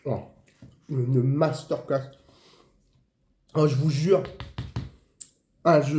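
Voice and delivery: talking while chewing meat; muffled savoring through food; mouth full, chewing. Real, not acted. Seen 2: Bites figatelli at -2; chews with puffed cheeks, pursed lips throughout.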